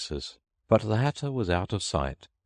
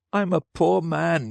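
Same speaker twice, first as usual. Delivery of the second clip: high, loud, slow plea; a desperate hatter begging the king; 11 semitones higher, 4 dB louder than usual. Acted. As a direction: beg from one knee. Hatter on one knee.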